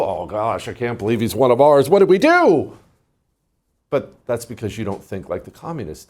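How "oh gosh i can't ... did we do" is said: building from muttered disbelief to a loud wail; mock embarrassed dismay. Host voicing an ashamed ally of the judge, mock dismay. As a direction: act appalled, rising sharply in pitch and volume. Acts out an embarrassed party member.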